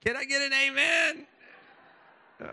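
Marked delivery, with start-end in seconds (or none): 0.0-1.3 louder, higher preacher shout for an amen; rally the congregation, hammed-up; mock call-and-response to the church.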